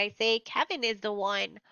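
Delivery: ordinary delivery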